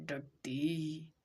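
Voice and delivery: डटी drawn out very slowly; slowly sounded out. Shown as a demonstration. Teacher stretches डटी again for students, very slowly. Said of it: "ड का sound आ रहा है।".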